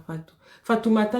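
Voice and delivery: ordinary talk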